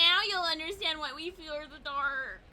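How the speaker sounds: hint of a brooding villain voice; imitating Revenant. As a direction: ham up the spooky threat.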